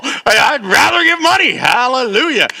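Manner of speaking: high, loud comic outburst; loud, high mock-preacher cry; joking exclamation. Real, not acted. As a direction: belt it out as a jubilant joke. Jokingly exclaiming he'd rather give money.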